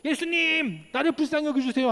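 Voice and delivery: high, urgent plea to Jesus, pitched well up; a beggar shouting his plea above the crowd to Jesus, voice pitched high. Retold or imitated. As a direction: cry out over the crowd, pitch rising high. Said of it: "더 큰 목소리로".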